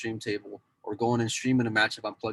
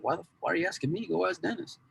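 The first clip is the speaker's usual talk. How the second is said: mock exasperated comeback, pitched up; incredulous retort; 7 semitones higher, 4 dB quieter than usual. Telling a podcast host how he answered a nosy question, mock exasperated. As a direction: toss it back like an obvious question, half-laughing. Retelling being asked about Dennis.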